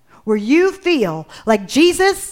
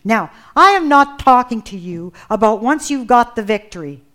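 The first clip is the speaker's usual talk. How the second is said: ordinary talk, nothing marked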